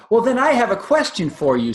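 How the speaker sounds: put-on baby elephant calling to the crocodile; squeaky baby elephant. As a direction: call out like an excited young elephant. Baby elephant voiced in story.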